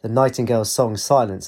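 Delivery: ordinary delivery, nothing marked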